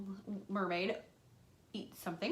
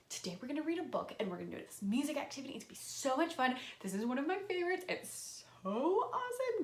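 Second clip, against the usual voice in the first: fast, bubbly hype building higher; excited teacher; 5 semitones higher than usual. Shown as a demonstration. Teacher hyping up a book for her class. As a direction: sell it with pure enthusiasm. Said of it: "in a really exciting way".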